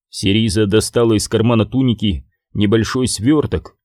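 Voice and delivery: ordinary delivery, nothing marked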